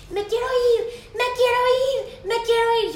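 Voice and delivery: high wailing kid voice, mildly hammed up; whiny child. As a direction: whine it like a toddler in a tantrum. Niece crying on the floor.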